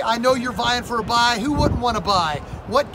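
usual speaking voice

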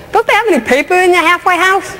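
high, mocking dig at the kid; sarcastic teacher ribbing a student; teasing jab. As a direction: needle him with a grin. Teasing a tattooed kid in class.

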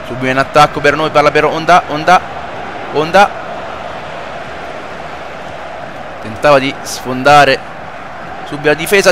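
live play-by-play. Acted. Plays football video game commentating.

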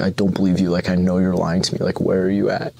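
ordinary talk

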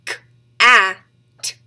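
"K, a, t" as three isolated sounds; sounded out. Shown as a demonstration. Teacher asking for the middle sound, sounded out. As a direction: break "K, a, t" apart, leaning loudly on the vowel. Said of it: "What sound did you hear".

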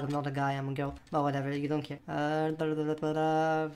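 normal talking voice

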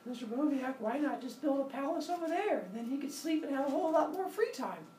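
put-on high voice of a curious kid asking; high childlike questioning voice; student's question. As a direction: play the eager student asking the teacher.

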